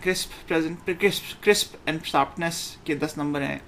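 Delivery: normal talking voice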